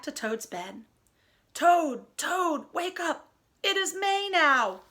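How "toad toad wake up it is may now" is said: waking call. Acted. Frog waking Toad.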